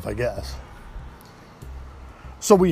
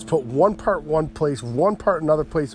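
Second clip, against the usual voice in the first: usual speaking voice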